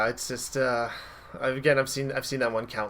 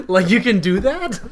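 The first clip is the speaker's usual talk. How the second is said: high, loud, slowed-down disbelief; mock amazement; 6 semitones higher, 10 dB louder than usual. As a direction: act out a kid's astonished discovery. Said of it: "in my head I was like".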